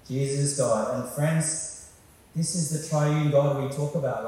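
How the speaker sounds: usual speaking voice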